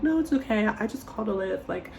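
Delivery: high-pitched sweet brush-off; fake breezy decline. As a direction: wave him off brightly. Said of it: "I was like".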